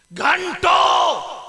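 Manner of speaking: drawn-out anguished cry; long wail. Acted. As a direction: hold the word out in a preacher's howl. Preacher's drawn-out wailed word.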